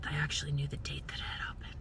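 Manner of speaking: sheepish whisper; whispered aside. Real, not acted. Vlogger admitting to viewers in a whisper. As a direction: confess it under your breath.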